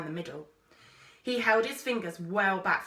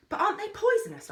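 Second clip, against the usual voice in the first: quick, high, curious question; eager interest; 14 semitones higher, 5 dB louder, faster than usual. A narrator's reading. Girl keenly asks the explorer about poison, quick and high. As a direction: rush the question out, keen.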